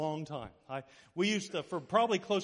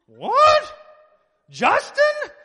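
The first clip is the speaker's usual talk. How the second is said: loud, high-pitched incredulous yell; hammed-up cry of total astonishment; shocked disbelief; 19 dB louder, much slower than usual. Retells his disbelief about Justin.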